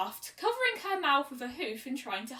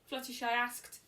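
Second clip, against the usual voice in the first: usual speaking voice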